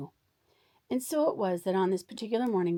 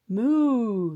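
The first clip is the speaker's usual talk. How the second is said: a booming moo, much louder; big loud moo; 4 semitones higher, 9 dB louder than usual. Acted. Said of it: "the sound was so big and so loud".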